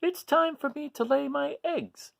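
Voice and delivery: high, sing-song lady seahorse voice; a gentle mother fish announcing it's time; Missus Seahorse. Acted. Reading to children, Missus Seahorse speaks in a high voice.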